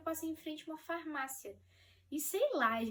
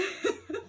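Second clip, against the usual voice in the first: bright burst of laughter; happy laugh; 9 semitones higher, 8 dB louder than usual. Real, not acted. Laughing while remembering the moment. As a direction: laugh out loud with delight. Joyful reaction to pregnancy.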